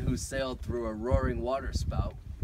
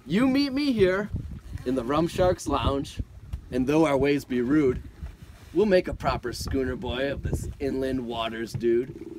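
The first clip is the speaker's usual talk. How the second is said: loud sailor voice; 8 dB louder than usual. Acted. Voicing Bosun Dan.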